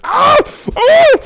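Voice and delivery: short, high, loud cries of pain; sharp yelps from an injured man; pained yell. Acted. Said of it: "he's yelling a bit".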